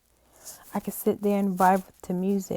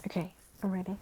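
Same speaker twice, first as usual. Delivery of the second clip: soft, teary, pulling together; real tears fading, softly; composing herself; 16 dB quieter, much faster than usual. Real, not acted. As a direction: recover from crying, quietly. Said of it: "give me a minute".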